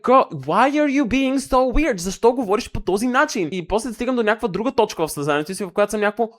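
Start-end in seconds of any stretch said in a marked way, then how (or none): 0.0-3.5 mock puzzled friend. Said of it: "I'm like".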